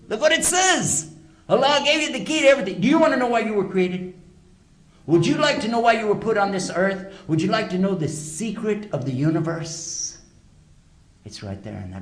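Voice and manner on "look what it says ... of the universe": high, loud preacher's cry of revelation; fervent preaching. Real, not acted. Preacher urging the audience to learn why they were created, loud and high. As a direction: preach it out, louder and higher, to stir the crowd.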